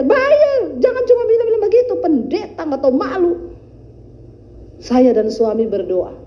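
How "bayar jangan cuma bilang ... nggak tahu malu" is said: angry scolding. Retold or imitated. Debt collector yelling at the pastor about her debt, high angry outburst. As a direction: shout her down for not paying.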